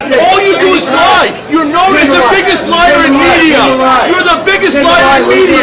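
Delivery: furious shout. Real, not acted. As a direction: bellow it right in his face. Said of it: "He's actually mad".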